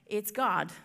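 ordinary talk, nothing marked

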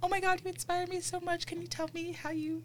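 high, soft fangirl voice; sweetly pleading in a small high voice; gushing admirer. Acted. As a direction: play a starstruck student messaging an idol. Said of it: "just D M them and say".

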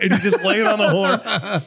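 high, laughing through the words; can't hold back the laugh telling it; real giggle breaking into the line; laughing while telling. Real, not acted. Laughing through funny story.

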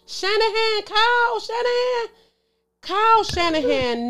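high, loud repeated Kyle Shanahan; exasperated call-out. Real, not acted. Fan scolding coach Shanahan, high exasperated calling out. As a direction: call his name out in disbelief.

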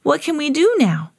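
put-on high eager child question; little pig raring to play; excited cry. A narrator's reading. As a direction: ask keenly in a high voice. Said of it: "cried Peppa".

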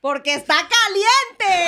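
loud, high, drawn-out cheer; grito eufórico. Real, not acted. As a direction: whoop it out slowly over applause. Excited cheer after the performance. (grito alargado y eufórico)

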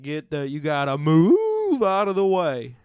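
loud, slow southern cow bellowing down a hill; slow drawling cartoon cow. Eighties cartoon cow shouting while sledding downhill, loud slow drawl. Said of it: "You have to be like".

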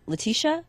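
Letitia asked high and questioning; misnaming her as Letitia, rising; mistaken name. Retold or imitated. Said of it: "and they're like".

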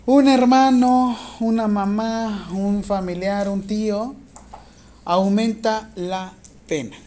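loud, slow dictation in chunks. Real, not acted. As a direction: dictate slowly and clearly, projecting to the class. Teacher dictating exam question to students.

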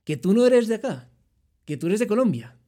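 put-on lightly done Spanish accent from Spain; a hint of a Spanish receptionist's voice. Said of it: "el chico me dice". (con un leve acento español de España)

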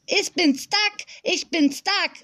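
put-on high, slow childish chant; singsong little girl. A narrator's reading. Small girl carries a table, chanting in singsong. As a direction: sing it out high like a proud toddler. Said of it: "sang die kleine Fee".

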